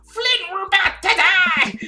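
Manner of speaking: high, loud, slow hammed-up scream; drawn-out panicked yell; terrified screaming. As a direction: wail it in melodramatic terror. Acted trucker in mortal peril.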